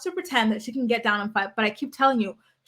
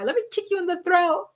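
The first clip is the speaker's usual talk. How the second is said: higher childish mock threat; little boy voice; 7 semitones higher than usual. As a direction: say it like a seven-year-old boy. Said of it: "something he would say as a little boy".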